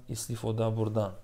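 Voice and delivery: ordinary delivery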